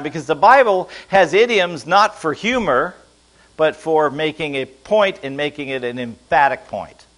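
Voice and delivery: normal talking voice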